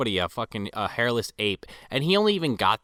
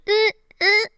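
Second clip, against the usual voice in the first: very high, loud squawking grunts; nasal duck grunts; 8 dB louder than usual. Retold or imitated. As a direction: grunt like a duck pushing a cart. Howard imitated pushing cart.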